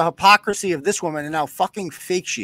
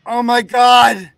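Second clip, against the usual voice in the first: mock panic; 3 semitones higher, 9 dB louder, slower than usual. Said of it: "She's like".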